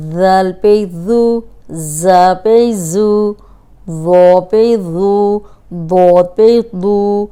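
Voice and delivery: each زو spelt slowly with its letter; slow letter drill. Shown as a demonstration. Teacher drilling letters, a slow spelled-out زو. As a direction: spell out the four زو one by one. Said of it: "ان چاروں کو زو".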